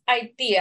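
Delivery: Haití said the Spanish way, stressed on the last syllable; Haití with Spanish vowels and final stress; Spanish pronunciation. Shown as a demonstration. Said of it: "Well, in Spanish, it's".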